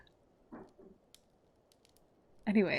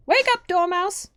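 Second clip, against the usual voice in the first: high, loud and slow; shout; 12 semitones higher, 7 dB louder than usual. Acted. Hatter and Hare shouting the Dormouse awake. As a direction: yell to wake him.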